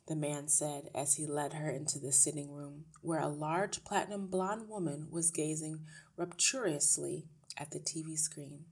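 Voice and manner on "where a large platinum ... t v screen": ordinary delivery, nothing marked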